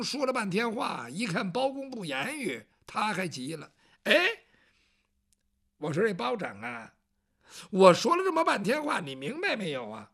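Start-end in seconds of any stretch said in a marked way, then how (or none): 4.0-10.1 a hint of a fussy eunuch nagging; impatient eunuch. Eunuch Yang Zhong nags silent Bao Zheng, mildly impatient. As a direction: prod the silent listener.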